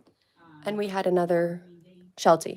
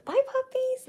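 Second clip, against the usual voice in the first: much higher, cooing; high puppy voice. Real, not acted. Showing puppies to camera.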